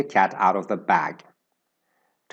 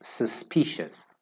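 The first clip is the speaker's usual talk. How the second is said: whispered model word; 7 dB quieter, slower than usual. Shown as a demonstration. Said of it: "And the adjective is suspicious".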